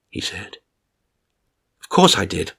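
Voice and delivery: ordinary delivery, nothing marked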